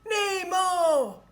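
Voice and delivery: high, loud call for his lost son; crying out. A narrator's reading. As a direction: shout his son's name in alarm. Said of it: "cried Marlin".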